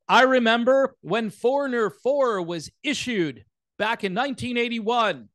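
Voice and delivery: high, hammed-up parody of stilted writing; mock article-speak. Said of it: "Who talks like this?".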